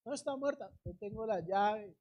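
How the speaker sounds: soft, understated certainty; quiet assurance. Acted. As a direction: answer softly, sure of your authority. Acts Jesus's answer, first soft take. (seguridad serena, en voz baja)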